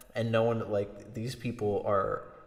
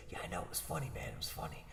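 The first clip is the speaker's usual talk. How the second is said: soft whispered brush-off; 8 semitones lower, 13 dB quieter, much faster than usual. Retold or imitated. Key whispers back to Peele, hushed.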